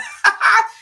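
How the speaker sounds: high, loud burst of laughter; sudden laugh. Real, not acted. Laughing about magnesium.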